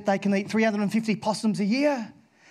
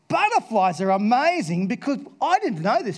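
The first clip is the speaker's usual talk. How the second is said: ordinary delivery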